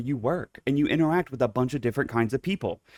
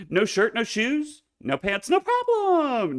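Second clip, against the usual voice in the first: playful; 12 semitones higher, slower than usual. Pretend. Mock decree, playful.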